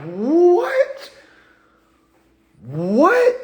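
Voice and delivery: very high, loud, rising cry of disbelief; hammed-up stunned yelp sweeping upward; mock shock. Speaker imagines everybody's reaction to the outburst, in exaggerated loud shock. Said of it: "I'ma have everybody like".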